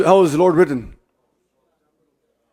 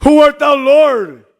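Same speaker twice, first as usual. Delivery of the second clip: Saul crying out; 8 semitones higher, much slower than usual. Acted. Preacher voicing Saul's cry.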